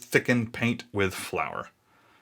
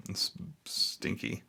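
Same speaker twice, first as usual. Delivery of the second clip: quiet aside; 3 semitones lower, 10 dB quieter, much slower than usual. Real, not acted. Quiet aside on a search result.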